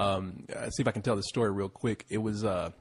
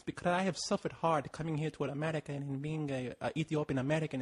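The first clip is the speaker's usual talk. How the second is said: softer, roughly imitated Ethiopian accent; attempted Ethiopian accent; 3 semitones higher, 6 dB quieter than usual. Ethiopian woman explaining her life story, imitated Ethiopian accent. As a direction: do a loose Ethiopian accent, softly. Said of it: "I can't really do the accent".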